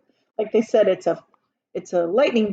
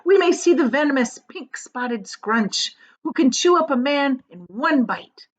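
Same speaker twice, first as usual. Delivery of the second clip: reciting a poem; 5 semitones higher than usual. A narrator's reading. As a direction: declaim it like a comic rhyme. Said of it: "he's doing a poem".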